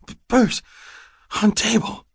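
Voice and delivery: high, halting fragments; wheezing. A narrator's reading. Asthmatic woman directs man to her purse, wheezing. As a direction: squeeze words out between short breaths. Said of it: "she wheezed".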